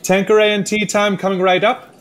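high-pitched, chirpy order confirmation; chirpy waiter. Acted. Waiter confirms the drink order to diner, chirpy.

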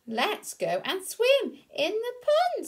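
high, slow cheerful duck voice; Dizzy Duck. Acted. Dizzy Duck inviting ducklings to swim, high and slow. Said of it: "said Dizzy".

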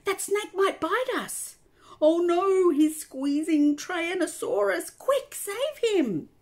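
high, loud alarmed cries; urgent shout. A narrator's reading. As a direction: shout in panic to save a friend. Harry shouting about snake.

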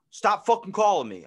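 loud, high angry yell, imitated; upset caller. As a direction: yell down the phone like a fed-up caller. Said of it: "he's all, all upset".

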